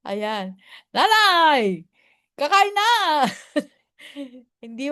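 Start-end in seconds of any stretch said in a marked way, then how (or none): 0.9-2.9 put-on high, loud call to a deaf old woman; yelling that it's time to eat; shouting to nanay. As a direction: call out so grandma hears. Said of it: "Sumisigaw ko na".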